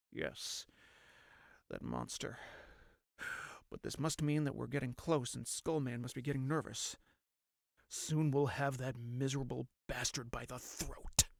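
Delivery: hushed, low, grim resolve; grim pursuer of Skullman. Acted. Vowing to catch the monster Skullman, low and grim. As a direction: mutter it low with quiet hatred.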